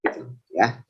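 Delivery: ordinary delivery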